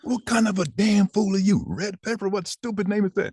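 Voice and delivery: very high mock outraged father; imitating furious dad. Father scolding his son over the name, high mock outrage. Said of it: "He said".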